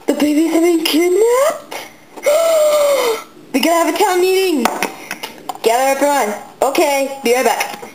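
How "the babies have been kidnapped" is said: gasping, high and shocked. Pretend. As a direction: gasp in horror at the news. Shocked news of kidnapping.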